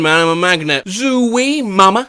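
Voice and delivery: ordinary delivery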